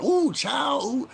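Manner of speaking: loud, sky-high falsetto wail; mocking podcaster impression. Saying nobody in his family talks so, mocking high wail. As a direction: squeal it as a hammed-up caricature. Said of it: "that talks like that".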